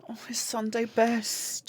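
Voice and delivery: slow, soft whispery interjection; hushed aside. Pretend. Co-host cutting in about his Sunday best, hushed whisper. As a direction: breathe it out slowly, almost without voice.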